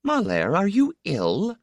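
high-pitched mother, rising in concern; mother checking on her son; mother's questioning. A narrator's reading.

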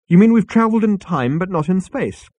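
pleased to have worked it out; brightly. A narrator's reading. Arthur brightly guesses to the waiter, eager. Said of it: "said Arthur brightly".

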